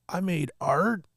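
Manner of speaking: put-on a little boy surprised he made something; imitating amazed kid. Nephew voiced in a story.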